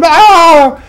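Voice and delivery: loud, high comic yell of fright; mock scream. Spider-fearing viewer screaming at the TV, a loud mock scream. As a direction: scream in hammed-up terror. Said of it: "I'm screaming at the T V".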